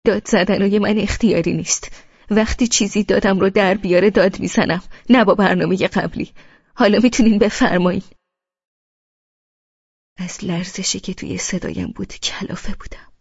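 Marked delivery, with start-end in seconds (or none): 0.0-8.0 louder and louder, the last line rushed; resentful retort. Acted. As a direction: bite back, stung and defiant. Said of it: "با حرص گفتم".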